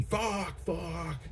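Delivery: high, hushed mock scream; small, high stifled mock yell; retold scream. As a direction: imitate screaming with the volume held way down. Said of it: "smacking himself in the face and screaming".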